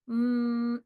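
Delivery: ordinary delivery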